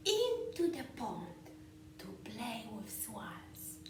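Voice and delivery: lively, sing-song picture-book read-aloud with whispered bits; reading to children. A narrator's reading.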